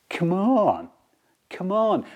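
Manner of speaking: ordinary delivery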